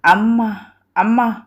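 put-on narrated child calling through tears; mock-crying plea for mother; acted tearful. A narrator's reading. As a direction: call out as if weeping, in short separate sobbed words. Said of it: "ఏడుస్తూ అంది అంజలి".

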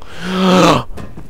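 sharp intake of breath in fright; frightened gasp. Real, not acted. Player waiting for the zombie, frightened gasp. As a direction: gasp in dread.

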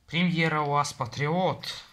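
ordinary talk, nothing marked

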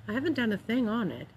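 usual speaking voice

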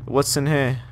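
usual speaking voice

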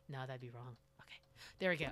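ordinary delivery

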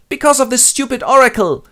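high, loud complaint; whining Creon. Acted. Creon complaining to Jocasta, high and loud. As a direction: tattle to the queen.

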